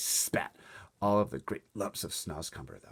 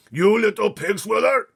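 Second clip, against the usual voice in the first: furious roar; 17 dB louder than usual. Acted. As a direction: roar the insult at the other giant. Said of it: "roared the B F G".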